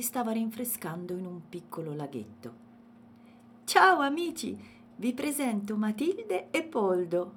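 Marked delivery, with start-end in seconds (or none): 3.6-7.3 high, loud, bouncy greeting; cheerful little elephant. A narrator's reading. Elephant presents Matilde and Poldo to friends, cheerful. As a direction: call out happily to friends.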